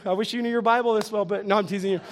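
usual speaking voice

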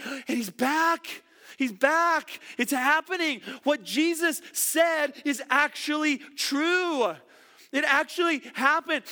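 the thrill of realizing he's back; excited. Retold or imitated. Mary realizing Jesus is risen, excited and rising. Preacher voicing Mary's joyful realization.

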